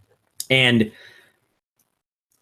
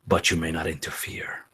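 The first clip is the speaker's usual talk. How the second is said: breathy whisper, low and soft; a ghost whispering the terms of the bargain; whispered warning; 7 semitones lower, 8 dB quieter than usual. Acted. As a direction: whisper the condition firmly. Piotr warns the sleeper.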